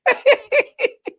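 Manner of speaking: high laugh. Real, not acted. Laughs at chat.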